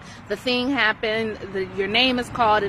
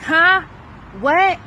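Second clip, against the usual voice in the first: jolted, louder and higher call-back; interrupted mid-task, startled irritable what; startled huh what; 7 semitones higher, 7 dB louder than usual. Retold or imitated. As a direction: snap out of focus with a sharp huh?. Startled reaction to name called.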